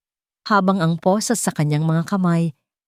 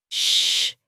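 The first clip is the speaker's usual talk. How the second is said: put-on whispered shush to a crying child; soothing shush; 3 dB quieter than usual. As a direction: hush the baby softly. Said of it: "bulong ni Esteban".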